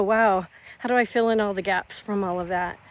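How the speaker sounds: ordinary delivery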